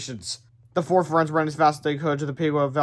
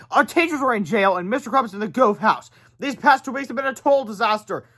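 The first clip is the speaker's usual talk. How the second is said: put-on higher kid voice, fed up; boy's voice, higher, complaining; 7 semitones higher than usual. A narrator's reading. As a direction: whine it like a frustrated boy. George voiced, complaining angrily.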